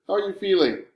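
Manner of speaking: woman's voice. A narrator's reading. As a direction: lift it into a mother's caring question. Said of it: "comes a woman's voice over the computer".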